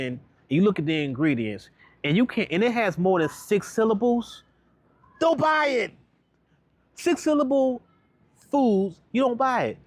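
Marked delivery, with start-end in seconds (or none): 2.2-9.8 fired-up warning. Real, not acted. Coach warning about unpronounceable ingredients.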